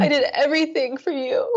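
words breaking into tears; real crying at her father. Real, not acted. Client confronting dead father.

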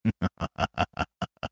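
quiet, breathy, drawn-out chuckle; low long laugh. Acted. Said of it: "laughed low but long".

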